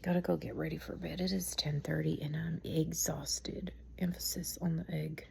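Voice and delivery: hushed late-night whisper, barely voiced; whispering so as not to wake anyone. Real, not acted. Tired mom whispering at bedtime.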